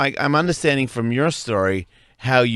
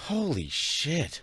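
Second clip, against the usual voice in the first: stunned disbelief; 6 semitones higher, 7 dB quieter, much slower than usual. Real, not acted. Stunned reaction to pimp story.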